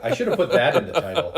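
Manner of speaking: talking through a laugh; quick, laughing it out. Real, not acted. Laughing at own joke.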